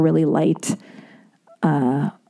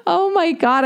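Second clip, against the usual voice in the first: laughing; 9 semitones higher than usual. Real, not acted. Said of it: "I'm, like, stuttering".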